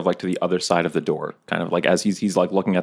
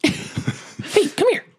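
very high falsetto come-hither; severed-hand puppet voice. Pretend. Player luring goblins with floppy hand, high falsetto. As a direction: lure them with a squeaky high puppet voice.

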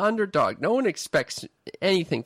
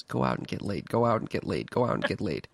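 low, repeating like a looping thought; nagging inner voice; 8 semitones lower, 4 dB quieter than usual. Retold or imitated. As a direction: echo the friend's advice in your head, lower. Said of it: "all I'm gonna hear in the back of my mind is".

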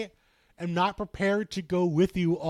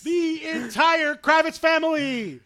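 announcer voice; 8 semitones higher, 4 dB louder than usual. Pretend. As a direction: announce it like a big event. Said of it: "You got a good announcer voice".

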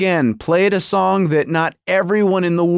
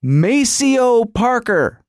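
hyped-up imitation of a concert introduction; stage announcer; 7 semitones higher than usual. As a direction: announce the star, stretching out the name. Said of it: "It's like".

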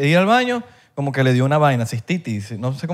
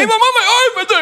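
put-on squeaky high little kid voice; whiny mama's boy voice, very high; mock child voice; 3 dB louder than usual. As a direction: pipe it up like a tiny child. Repeating phrase in mock voice.

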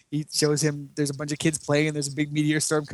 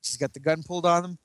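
normal talking voice

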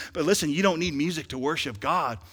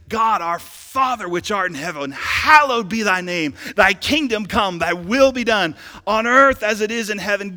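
loud, raised preaching voice; fervent preached prayer; 4 semitones higher, 8 dB louder than usual. Acted. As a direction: pray it out boldly to the whole church. Preaches a prayer at full force.

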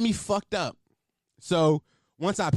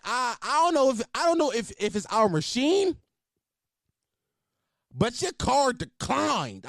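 waitress impression; 6 semitones higher than usual. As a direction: parody the waitress making a show of it. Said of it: "She ain't had to say it like that".

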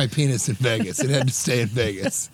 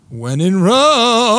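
loud, high, slow, stretched-out punchline; catchphrase cadence; 7 semitones higher, 8 dB louder, much slower than usual. Acted. Drinker excusing himself to friends, drawn-out catchphrase cadence. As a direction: lift and hold "Rome" like a big punchline. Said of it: "cadence and adding it to it".

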